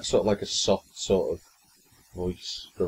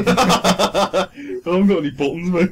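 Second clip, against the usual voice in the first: laughing; 9 semitones higher, 8 dB louder than usual. Real, not acted. Teasing his friend about buttons, laughing. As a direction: giggle through the words.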